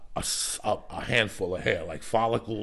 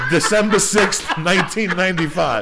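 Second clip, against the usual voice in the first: laughing; 9 semitones higher, 11 dB louder than usual. Real, not acted. Laughing while giving date.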